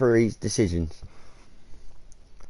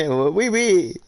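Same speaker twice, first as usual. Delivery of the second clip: giggly wee wee; 8 semitones higher, slower than usual. Acted. Giggling at the French.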